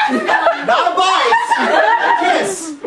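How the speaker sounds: high, slow laughing protest; real laughing objection; laughing correction. Real, not acted. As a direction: squeal the correction through laughter.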